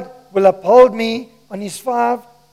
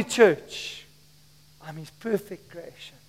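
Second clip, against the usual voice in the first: ordinary talk, nothing marked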